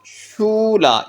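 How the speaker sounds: शूला stretched slowly with a long oo. Shown as a demonstration. Teacher stretches the word to show the sound. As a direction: draw out the oo in शूला. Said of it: "ये देखें यू की sound".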